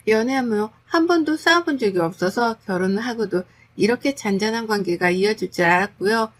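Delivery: usual speaking voice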